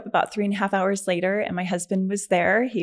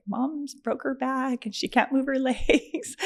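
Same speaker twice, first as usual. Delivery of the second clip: lightly voiced daughter, higher and slow; daughter on phone; 6 semitones higher, slower than usual. Daughter calls husband about mom's injury, higher-pitched retelling. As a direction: imitate the daughter's call, pitched up a little. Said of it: "my daughter's on the phone".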